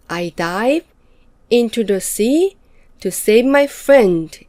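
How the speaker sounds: slow read, "dive", "sea" and "friend" leaned on; stress on dive, sea, friend. Shown as a demonstration. Teacher demonstrating phrasal stress, slowly. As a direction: stretch the sentence and punch "dive", "sea", "friend". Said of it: "dive sea, friend의 강세가 올 것이고".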